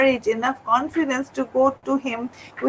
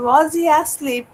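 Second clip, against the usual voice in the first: ordinary delivery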